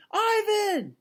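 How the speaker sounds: put-on high shout of a girl's voice; girl's yell. Girl calling to her gorilla friend, a high shout. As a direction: call out his name again, eager. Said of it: "the girl yells".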